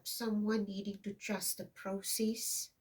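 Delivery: ordinary talk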